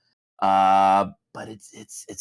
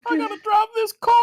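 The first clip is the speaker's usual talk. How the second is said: very high, near-crying voice; high-pitched mock-tearful Southern impression; Camelot; faster than usual. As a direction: squeeze it way up, on the verge of tears. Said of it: "He just sounds like he's about to cry".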